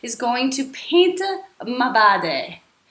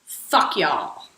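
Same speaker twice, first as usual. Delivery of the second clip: demo line; 8 semitones lower than usual. Shown as a demonstration. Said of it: "just practice saying".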